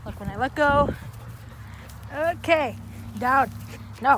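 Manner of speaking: loud commands to the dog. Real, not acted. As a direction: order the dog down, firmly.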